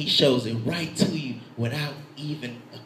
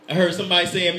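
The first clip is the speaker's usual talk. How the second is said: normal talking voice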